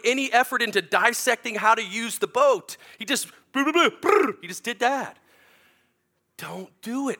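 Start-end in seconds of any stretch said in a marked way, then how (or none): 3.0-4.4 high, loud mock engine sputter; playful motor noise for the crowd; imitated sputtering motor. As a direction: buzz your lips like a dead outboard. Clueless driver revving motor.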